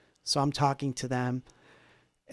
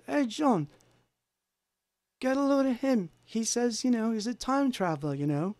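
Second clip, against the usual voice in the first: imitating Paul; 8 semitones higher than usual. Paul voiced in retold dream.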